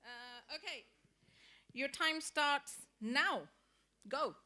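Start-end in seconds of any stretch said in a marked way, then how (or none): 1.7-4.4 loud, slow announcement to the whole class; calling out to the room. Real, not acted. Teacher starting the timer loudly over a noisy room. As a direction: call the start so everyone hears. Timing a pair activity.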